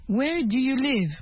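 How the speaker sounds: ordinary talk, nothing marked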